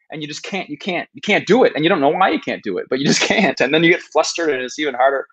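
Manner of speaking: usual speaking voice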